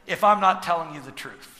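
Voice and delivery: high, loud, driving sermon delivery; full-force preaching. Acted.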